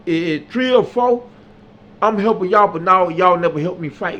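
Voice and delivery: loud scolding. Retold or imitated. Telling friends they let him fight alone, heated scolding. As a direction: rip into them for watching.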